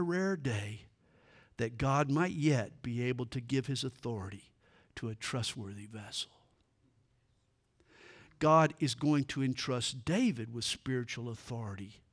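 ordinary delivery

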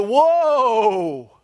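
slow drawn-out "whoa" like braking. Shown as a demonstration. Preacher slowing Israel down with a drawn-out whoa. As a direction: pull up on "whoa". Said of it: "like a speed bump".

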